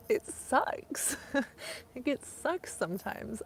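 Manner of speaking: fighting back tears; getting emotional. Real, not acted. Telling viewers about hard times, getting emotional. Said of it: "Oh, I'm getting emotional".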